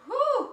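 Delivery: sharp high whoop of relief; relieved whoop. Retold or imitated. Whooping at herself after freeing herself. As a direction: let out a high, loud whoop.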